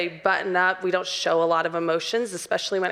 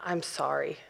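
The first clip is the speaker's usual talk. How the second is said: low, soft token sorry; quick-fix apology; 7 semitones lower, 8 dB quieter than usual. Retold or imitated. Wife apologizing to husband just to end a fight, token apology. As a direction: drop into a soft, easy apology. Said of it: "really good at just going".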